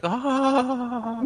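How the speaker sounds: long, high coo of wonder; mock-blissful, drawn-out aah; adoring "aah". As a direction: sigh as if cradling a newborn. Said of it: "cradling a newborn baby".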